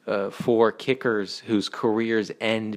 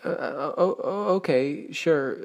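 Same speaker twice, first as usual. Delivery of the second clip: surprised; 8 semitones higher, slower than usual. Real, not acted. Startled by the cryptic answer.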